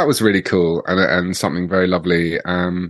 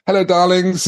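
high, gushing hello darlings; theatrical diva greeting; 11 semitones higher than usual. Retold or imitated. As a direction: lightly mimic a grand actress. Imitating Joan Collins greeting.

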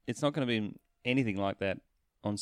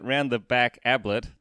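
mild mock yell; 2 semitones higher, 6 dB louder than usual. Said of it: "me yelling out".

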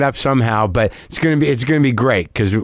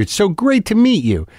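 "It's so great to meet you" much higher, with "great" pushed hard; earnest fan, gushing repeat; 10 semitones higher than usual. Retold or imitated. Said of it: "Very earnest guy".

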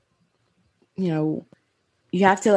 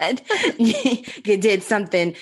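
laughing; 2 semitones higher, slower than usual. Real, not acted. Embarrassing story, giggling.

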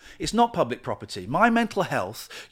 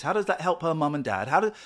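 ordinary talk

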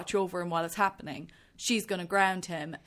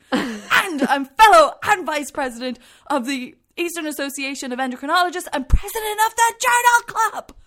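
builds from firm to a full high shout; escalating shout; 6 semitones higher, 10 dB louder than usual. Retold or imitated. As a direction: rattle off credentials louder and louder. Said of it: "he's still shouting".

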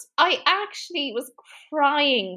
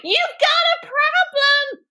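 high, slow, overacted accusation; hammed-up TV intervention; mock confrontation; 13 semitones higher, 4 dB louder, slower than usual. Zach confronts Jessie over pills, overdramatic accusation.

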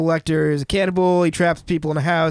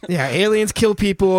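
normal talking voice

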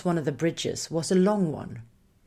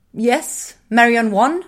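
raised, loud questioning demand; sharp voice; 6 semitones higher, 9 dB louder, slower than usual. A narrator's reading. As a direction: answer sharply, pitched high and loud. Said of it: "Tiki's voice was sharp now".

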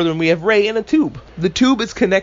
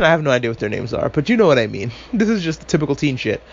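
ordinary talk

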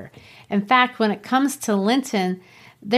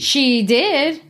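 sharp insistence; 6 semitones higher than usual. Acted. Kathy insisting.

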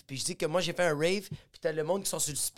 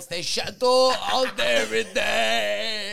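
slow showy brag; 3 semitones higher, 5 dB louder, slower than usual. Retold or imitated. Bragging about his old style.

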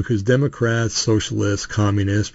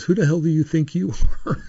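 mildly imitating an indignant man, pitched up; mock affronted challenge, higher voice; indignant comeback; 7 semitones higher than usual. Customer challenging the man who scolded him, mock indignant. Retold angry comeback in pharmacy.

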